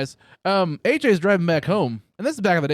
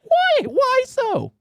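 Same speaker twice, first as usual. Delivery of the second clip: hammed-up innocent question, much higher; mock surprise; 6 dB louder, slower than usual. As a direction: play dumb in a squeaky mock-innocent voice. Mock naive question to friend.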